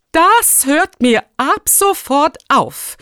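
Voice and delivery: high, loud, slow decree; stern proclamation. A narrator's reading. Rector putting an end to shoes indoors, loudly proclaiming. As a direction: announce it emphatically, word by word. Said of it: "verkündete Rektor Glauber".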